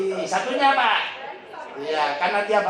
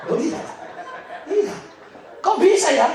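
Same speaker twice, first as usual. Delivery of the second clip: "Kok bisa ya" called out much higher and louder; loud amazement; 10 semitones higher, 4 dB louder than usual. Real, not acted. Preacher marvelling at God's provision to the congregation, loud and high. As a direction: throw "Kok bisa ya" out to the hall in delight.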